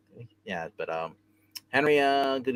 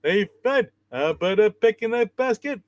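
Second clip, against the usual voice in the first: much higher, louder goofy cartoon sidekick; Barney Rubble voice; 10 semitones higher, 5 dB louder than usual. Host does the bear voice and friend says it sounds like Barney Rubble. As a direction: play it like a dopey cartoon sidekick. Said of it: "Now you sound like Barney Rubble Bear".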